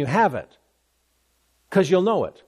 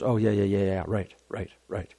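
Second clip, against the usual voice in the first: lower, much softer, half-hearted yeah, right; reluctant grudging yes; 8 semitones lower, 7 dB quieter than usual. Retold or imitated. Martha grudgingly agreeing to roll the stone away.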